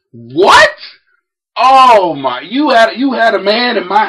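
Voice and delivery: loud shocked what; shocked outburst. Retold or imitated. Dad hearing about the back door, loud and shocked. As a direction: explode in disbelief.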